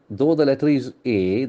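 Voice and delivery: ordinary talk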